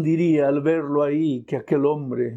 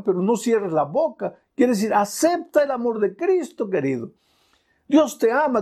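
impassioned sermon, rising and falling; fervent preaching; 5 semitones higher than usual. Acted. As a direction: preach with full conviction. Evangelical preaching. (predicación apasionada)

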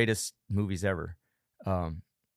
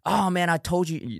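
higher scolding inner voice; nagging self-talk; 8 semitones higher, 3 dB louder than usual. Pretend. Talking down to yourself after missing a workout, mock scolding. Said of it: "this is self-talk".

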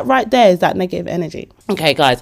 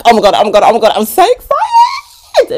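loud gushing building to a high squeal; childlike excitement; 4 semitones higher, 7 dB louder than usual. Acted. Gushing with childlike energy, squealing excitedly. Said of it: "Keep that childlike energy".

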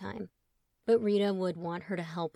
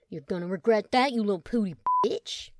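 threat; 4 dB louder than usual. Acted. Chet charging brother.

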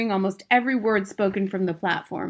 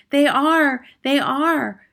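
higher, louder eager chorus; 8 semitones higher, 7 dB louder, slower than usual. Acted. English witches chorusing agreement to their leader, eager. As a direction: cheer it back as a crowd. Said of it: "chorused the English witches".

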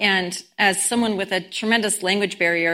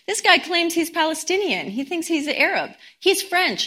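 higher-pitched, lightly acted incredulity; mock disbelief; 8 semitones higher than usual. Man telling his sister about the stranger, in mock disbelief. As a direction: report an absurd claim, imitating a doubter.

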